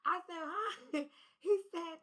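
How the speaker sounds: high, loud laughing disbelief; cracking up while retelling. Real, not acted. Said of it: "I'm laughing now".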